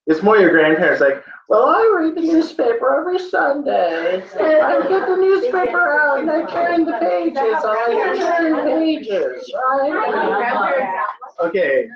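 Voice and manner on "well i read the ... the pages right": put-on high-pitched, drawn-out old grandparent. Teacher mocking students' grandparents reading the paper, high grandparent voice. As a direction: put on a much higher grandparent voice. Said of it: "that's a grandparent voice".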